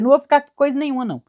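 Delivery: resposta atropelada, bem mais aguda; retruca rápido e alto. Retold or imitated. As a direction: solta a recusa de uma vez, rápido. (recusa apressada e aguda)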